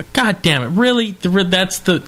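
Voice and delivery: a retold, half-hammed groan of disbelief; exasperated. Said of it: "I'm just seething with anger".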